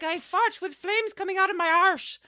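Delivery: high, loud hammed-up Scottish boast; mock Scottish accent. Wallace boasts to the English soldiers, mock Scottish and loud. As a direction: bellow it in a comic Scottish brogue. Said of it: "um, Scottish accent".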